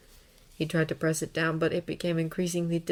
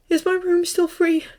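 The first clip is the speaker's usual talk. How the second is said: high, louder, tight question; strained asking; 12 semitones higher, 5 dB louder than usual. A narrator's reading. Draco choked up, asking his aunt, strained high question. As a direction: ask as if barely holding together. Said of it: "he asked".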